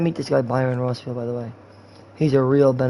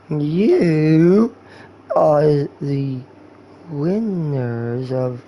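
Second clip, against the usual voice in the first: slow mock-drunk with long halting pauses; drunk guy; much slower than usual. Drunk guy denying it to his friend, slow and halting. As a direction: stretch each word like a hammered drunk. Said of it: "dude, you're drunk".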